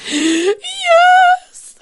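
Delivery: very high, loud wailed yes; mock sob stretched out; sobbing yes. Daughter imitates mom's sobbing answer, very high wail. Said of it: "are you crying like that".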